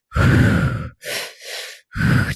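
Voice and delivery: audible breath in and out; demo breath. Shown as a demonstration. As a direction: show the breathing pattern with a loud breath. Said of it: "he taught the breath pattern".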